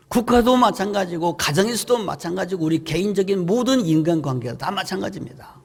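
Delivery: ordinary delivery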